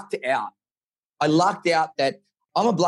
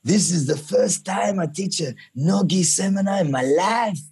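hammed-up Portuguese accent, slightly higher; bad Portuguese accent; 4 semitones higher than usual. Retelling his teacher's words in a bad Portuguese accent. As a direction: do a bad Portuguese accent quoting your professor. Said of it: "this is a really bad Portuguese accent".

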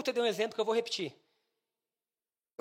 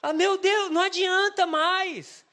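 high, loud, stretched-out wail; mock pleading cry; 7 semitones higher, 5 dB louder, much slower than usual. Preacher saying prayer alone is no use, hammed-up loud cry. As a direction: call on God in exaggerated desperation. Mock praying, laughing congregation.

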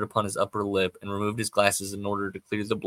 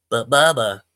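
put-on louder childish plea; protesting boy; 9 dB louder than usual. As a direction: whine a protest at mama.

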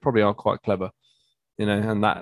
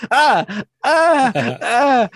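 high, loud hammed-up ah cries; mock pained cries; 12 semitones higher, 5 dB louder, much slower than usual. Band member hit by bird droppings, mock yelps. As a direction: imitate a disgusted yelp after the bird mess. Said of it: "and he just goes".

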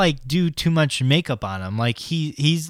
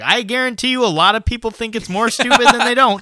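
Jim Carrey voice; 10 semitones higher, 3 dB louder, faster than usual. Retold or imitated. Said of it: "And he's like".

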